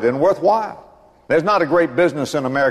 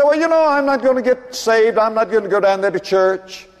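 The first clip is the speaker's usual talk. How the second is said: mocking excuse; 7 semitones higher than usual.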